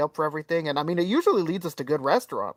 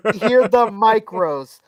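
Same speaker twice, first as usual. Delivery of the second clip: slow, raised, emphatic exclamation; loud punchline reveal; 4 semitones higher, 6 dB louder, slower than usual. Real, not acted. Storyteller hearing the microwaves, loud, slow and emphatic. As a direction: hammer each word out slowly.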